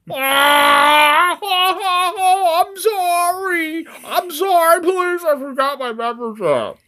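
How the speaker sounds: very high, loud, hammed-up agonised wail; wailing plea for mercy. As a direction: shriek the apology like a cartoon torture victim. Tortured shopper begging for mercy.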